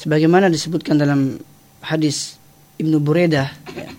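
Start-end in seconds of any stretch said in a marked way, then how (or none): none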